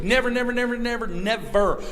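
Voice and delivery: each "never" punched loud and high; emphatic repetition. Acted. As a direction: pound every "never" like a drum. Hammering repeated never.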